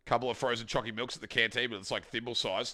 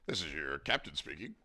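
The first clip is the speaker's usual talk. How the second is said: softer, lower captain line; pilot announcement; 7 semitones lower, 7 dB quieter than usual. Pretend. Streamer repeating the intercom line, softer.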